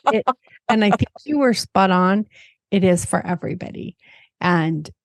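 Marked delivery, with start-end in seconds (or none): none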